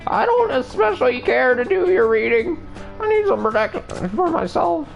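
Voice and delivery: put-on very high old woman voice; fortune teller. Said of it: "I don't do voices well".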